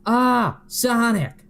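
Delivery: high, loud hammed-up moan; over-the-top squeaky moan. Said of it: "Shadow moans".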